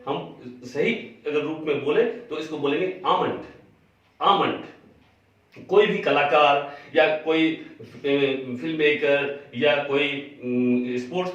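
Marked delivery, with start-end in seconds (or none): 2.4-5.6 आमंड said AH-mund, L silent, long drawn out; correct pronunciation almond. Shown as a demonstration. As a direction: stretch out आमंड slowly, no L. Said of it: "एल silent है". (आमंड, एल silent, धीरे खींचकर)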